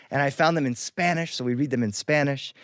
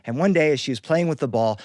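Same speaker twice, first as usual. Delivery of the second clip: plain reading voice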